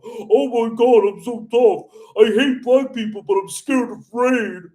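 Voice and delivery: hammed-up scared tough guy; mocking the heckler. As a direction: ridicule a fake tough guy with a put-on voice.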